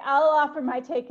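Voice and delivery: ordinary talk, nothing marked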